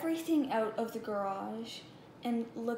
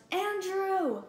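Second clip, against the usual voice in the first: high call for her brother; yelling; 7 semitones higher, 4 dB louder than usual. Acted. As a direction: call his name out loud. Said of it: "She yelled".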